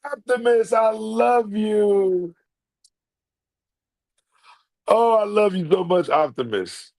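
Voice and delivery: drawn-out joyful adoration; gushing delight. Real, not acted. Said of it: "Oh! ... The King and I".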